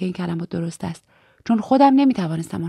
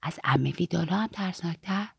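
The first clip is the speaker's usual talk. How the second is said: hushed, softer question; speaking quietly; 2 semitones lower, 7 dB quieter than usual. A narrator's reading. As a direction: ask it low-voiced, in confidence. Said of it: "من هم آرام گفتم". (پرسش آهسته و درگوشی)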